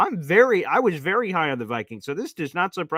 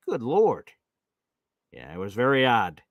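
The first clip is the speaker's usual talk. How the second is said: usual speaking voice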